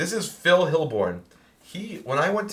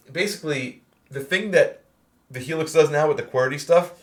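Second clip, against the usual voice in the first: ordinary talk, nothing marked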